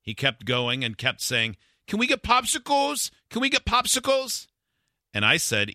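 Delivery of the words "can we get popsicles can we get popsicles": put-on much higher begging little kid voice; toddler voice. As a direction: plead like a small child. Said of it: "talking with a toddler accent".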